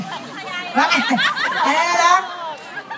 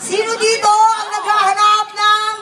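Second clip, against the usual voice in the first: projected, high-pitched host's shout-out; crowd hype call; 5 semitones higher than usual. Real, not acted. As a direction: call out to the whole audience, pitch climbing. Calling out to the audience.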